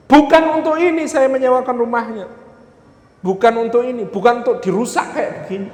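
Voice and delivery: high-pitched indignant outburst, easing toward the end; scolding landlord. Acted. Landlord finds children's drawings on the wall, acted indignant scolding. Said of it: "si pemilik rumah inspeksi, lalu mengatakan".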